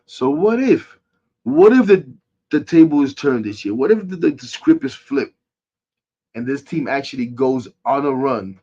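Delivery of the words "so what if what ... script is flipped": rising, hopeful push; excited what-if. Real, not acted. Fan rallying viewers about a turnaround, excited. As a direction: pump up the fans with hope.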